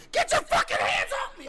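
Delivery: high, very loud screamed demand; hammed-up screaming protest; imitated scream. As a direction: shriek it as a mock public outburst.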